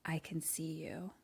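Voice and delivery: hushed, menacing whisper; barely voiced threat, very soft; creepy caller whisper. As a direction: whisper it like a phone stalker. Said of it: "just a voice that says".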